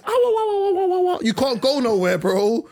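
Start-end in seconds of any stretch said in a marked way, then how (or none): 0.1-1.2 Mario Kart wail. Retold or imitated. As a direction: wail like a game character getting hit. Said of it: "all you're hearing is".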